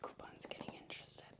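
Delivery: hushed aside so the cats stay calm; whispering. Real, not acted. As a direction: breathe it without voice. Aside while brushing, unvoiced.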